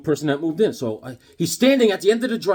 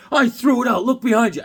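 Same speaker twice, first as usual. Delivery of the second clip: high, loud, indignant retelling; imitated angry neighbor; 8 semitones higher, 5 dB louder than usual. Imitating neighbor showing off the thrown-out bag, indignant. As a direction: play the irate neighbor, raised and emphatic. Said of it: "This guy's angry".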